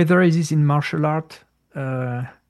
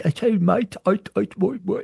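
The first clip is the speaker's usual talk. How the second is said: rough imitation of a stuttering Londoner's Cockney; stuttering Cockney accent; 3 semitones higher than usual. Imitating the missionary from London, stuttering Cockney accent. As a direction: put on Cockney and trip over the words. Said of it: "Cockney accent of London with stuttering".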